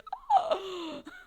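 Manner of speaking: very high gasp of horror; shocked gasp. Retold or imitated. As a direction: gasp like a horrified kid. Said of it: "I'd be like".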